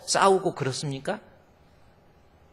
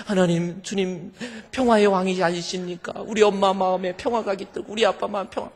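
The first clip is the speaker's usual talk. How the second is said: put-on earnest child prayer; 6 semitones higher, 4 dB louder than usual. Child's fervent prayer acted.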